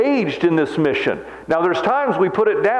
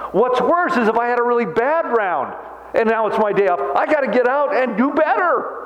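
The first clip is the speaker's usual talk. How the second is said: getting excited; 7 semitones higher than usual. Real, not acted. Preacher gushing to the congregation about golf, excited and higher. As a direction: voice climbs higher, eager and bright. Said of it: "I'm starting to get a little excited".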